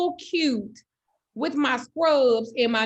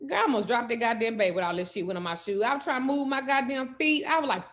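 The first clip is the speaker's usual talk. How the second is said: usual speaking voice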